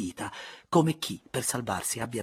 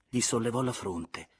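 usual speaking voice

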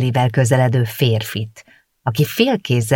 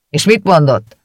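louder, pushy baker voice; gruff baker; 4 semitones higher, 5 dB louder than usual. A narrator's reading. Baker asks again when Zsuzsa returns, loud. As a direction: demand it again.

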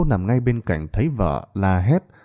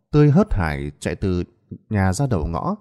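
ordinary delivery, nothing marked

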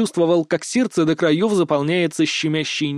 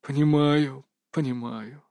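condescending grumble; 4 dB quieter than usual. A narrator's reading. Mage indulges Kris's apology, grumbling condescendingly. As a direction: grumble it indulgently, softer on the repeat. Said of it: "снисходительно проворчал маг".

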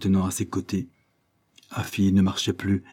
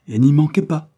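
loud, raised-pitch command; emphatic order; 8 semitones higher, 8 dB louder than usual. A narrator's reading. Ebenezer summoning Afi to court, emphatic loud order. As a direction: drive the warning home forcefully.

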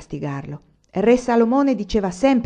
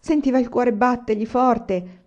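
ordinary delivery

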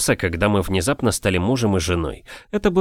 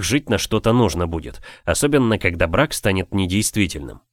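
usual speaking voice

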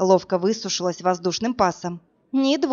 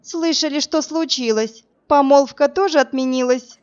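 higher, gloating needle; mocking taunt; 5 semitones higher, 3 dB louder than usual. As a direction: rub the broken engagement in. Senior student taunting the heroine.

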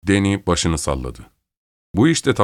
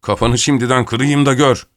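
higher-pitched angry threat; angry yell; 5 semitones higher, 3 dB louder than usual. A narrator's reading. Danny threatening to break Rivera's head, angry yell. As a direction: threaten him, voice raised in pitch. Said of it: "diye bağırdı".

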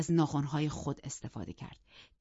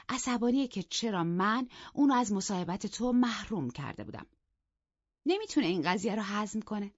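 a hint of higher mocking voice; taunting glee; 6 semitones higher, 3 dB louder than usual. Catherine goading Heathcliff about Isabella, lightly mocking. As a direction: rub it in playfully.

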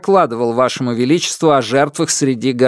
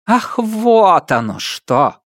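high, slow knowing sarcasm; arch; 8 semitones higher, much slower than usual. A narrator's reading. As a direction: feign sudden realization. Empress feigns realization.

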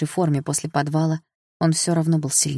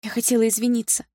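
high, timid apology; awkward apology; 7 semitones higher than usual. A narrator's reading. Sister apologises to ex-boyfriend, high and awkward. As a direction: apologise shyly, pitched up. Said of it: "не зная, куда деть взгляд".

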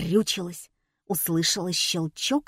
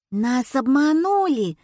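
drawn-out, higher-pitched dawning indignation; indignant realisation; 6 semitones higher, 4 dB louder than usual. A narrator's reading. Heroine realises the circus tricked them, indignant.

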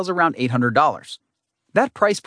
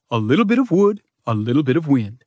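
lightly voiced Southern grill god. A narrator's reading. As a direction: pronounce it like a grand Southern deity. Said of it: "the grill god himself will pronounce".

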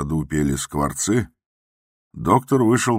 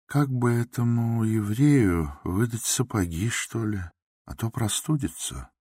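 higher, soft, considerate doctor; mild doctor; 7 semitones higher, 3 dB quieter than usual. A narrator's reading. The doctor asks the watchman to find boots, softly. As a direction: ask kindly, a touch higher and softer.

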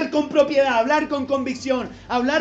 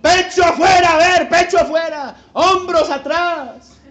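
loud, higher drill-sergeant orders; commanding call; 6 semitones higher, 10 dB louder than usual. Shown as a demonstration. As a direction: bark the posture like a coach. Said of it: "Cómo cree usted que anda un líder".

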